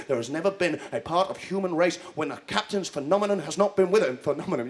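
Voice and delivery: ordinary delivery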